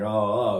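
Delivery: low-pitched vowel sample; low demonstration ah. Shown as a demonstration. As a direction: drop the ah down low. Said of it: "somebody talks this way".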